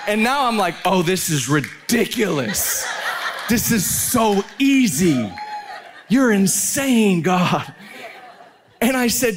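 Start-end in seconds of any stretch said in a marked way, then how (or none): 0.8-7.7 slow, laughing marvel; stretched-out incredulous laughter; giddy disbelief. Retold or imitated. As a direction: laugh through it, amazed and delighted.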